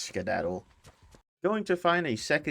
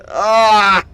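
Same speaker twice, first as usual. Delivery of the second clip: loud, higher startled scream; 9 semitones higher, 15 dB louder than usual. Real, not acted. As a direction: scream in real fright. Sudden scare.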